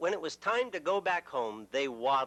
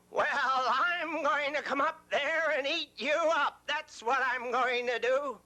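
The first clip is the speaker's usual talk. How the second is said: higher, growly troll menace; troll threat; 9 semitones higher than usual. Acted. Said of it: "troll threatens again".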